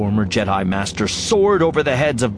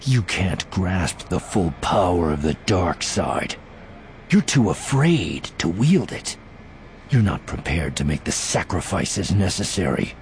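low, understated scorn; dripping contempt; 6 semitones lower, 4 dB quieter than usual. A narrator's reading. Voss taunts Desh as too afraid, contemptuous. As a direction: let quiet disdain drip from the words. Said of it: "his voice dripping contempt".

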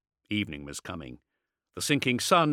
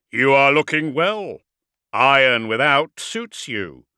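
lightly voiced warlord, loud and raised; mocking enemy warlord; 3 semitones higher, 9 dB louder than usual. A narrator's reading. Hidden warlord taunts the dreadnought, mocking. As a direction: project a taunt from cover.

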